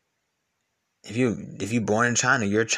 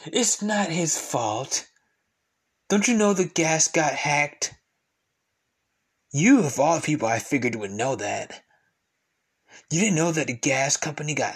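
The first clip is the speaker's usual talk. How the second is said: slow, high mocking defense; sarcastic mock; 4 semitones higher than usual. Mocking a Biden defender, slow and sarcastic. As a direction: drawl it out sarcastically.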